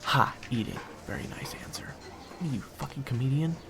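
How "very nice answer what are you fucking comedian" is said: dry, hushed sarcasm; sarcastic whisper. Acted. Waiter Zach mocking his friend in a sarcastic whisper. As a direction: whisper the sarcasm under your breath.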